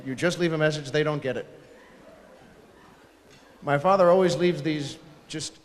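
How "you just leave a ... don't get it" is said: normal talking voice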